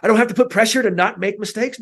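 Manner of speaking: relieved realization. Retold or imitated. Kid relieved of pressure.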